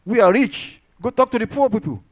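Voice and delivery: high, loud mocking voice of a boastful rich man; arrogant rich man. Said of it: "Somebody said".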